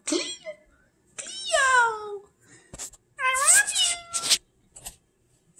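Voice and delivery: very high, soft cooing; doting owner cooing at a shy cat. Real, not acted. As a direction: coo her name up in a tiny voice. Baby talk to cat.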